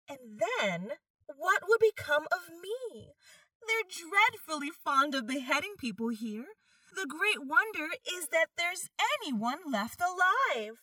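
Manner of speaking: softly voiced girl, a little uneasy; young girl voice. A narrator's reading. Alice's worried inner voice.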